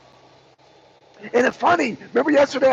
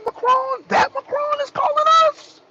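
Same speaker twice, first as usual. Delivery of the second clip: very high, astonished mock Putin; disbelieving Putin. Putin astonished at Macron's call, in a very high voice. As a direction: squeal the name in disbelief. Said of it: "I'm just reenacting the phone call".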